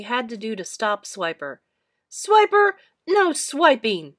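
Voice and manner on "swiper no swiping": very high, loud stern shout. A narrator's reading. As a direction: stop the sneaky fox firmly. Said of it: "they shouted".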